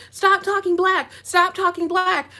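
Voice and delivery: high-pitched imitation of scolders; mock scolding. Commenters telling Woah Vicky off, mockingly.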